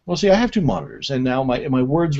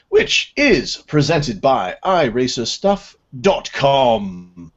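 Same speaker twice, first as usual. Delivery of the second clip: higher-pitched British reading voice; British accent; 5 semitones higher than usual. Real, not acted. Host reading the sponsor plug to listeners, in a British accent. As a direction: read the ad copy in a British accent. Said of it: "What's with the voice?".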